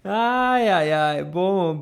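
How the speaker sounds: normal talking voice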